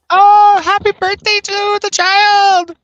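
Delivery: loud, high, slow birthday shout; excited cheer. Real, not acted. Calling happy birthday to a friend's son, excited and loud. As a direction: burst out celebrating.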